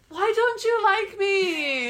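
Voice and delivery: high, loud, long-drawn whine; mock pleading. Imitating drunk suitor to friend, mock pleading. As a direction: drag it out as a wailing complaint.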